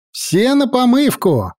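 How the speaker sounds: high, bright call to the crew; rally them with cheer; cheerful shout. Acted. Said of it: "разнёсся весёлый голос".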